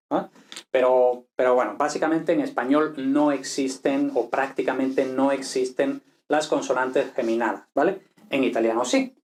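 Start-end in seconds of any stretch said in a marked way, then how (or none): none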